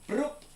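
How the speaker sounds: high squeaky pop noise; a silly cartoon pop as you appear; pop-up sound effect. Shown as a demonstration. Popping into frame with a high squeaky noise. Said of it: "but I go, like".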